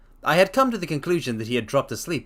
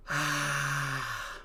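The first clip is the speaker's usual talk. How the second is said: ordinary delivery, nothing marked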